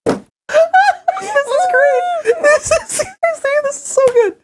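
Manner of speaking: high, slow words through laughter; laughing hard. Real, not acted. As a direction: squeal it out while laughing.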